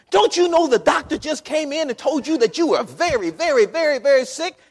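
high, loud, driving preacher's voice; full-force preaching. Acted. Preacher asking about peace amid trouble, high full-force delivery. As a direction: pile it up with rising fervor.

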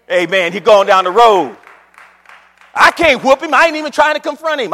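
loud, exultant pulpit shout; fired-up preacher at full volume; full-force preaching. Acted. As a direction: holler it to the congregation.

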